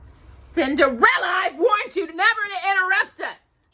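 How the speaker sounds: high, loud shouted scold; harsh scolding. A narrator's reading. Stepmother scolds Cinderella for interrupting, harsh shout. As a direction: snap at the intruding servant. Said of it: "her stepmother scolded her harshly".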